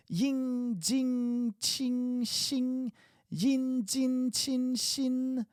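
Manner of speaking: ying, jing, qing, xing then yin, jin, qin, xin, all high; ing versus in drill. Shown as a demonstration. Teacher contrasting ing and in syllables, high. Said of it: "Okay, again".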